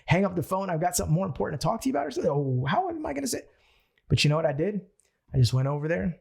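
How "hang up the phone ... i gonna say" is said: much higher, rushed, squeaky disbelief; panicked weaker self. Retold or imitated. His weaker self panicking at the bold idea, high and rushed. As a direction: sputter in alarm at the idea. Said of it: "And I was like".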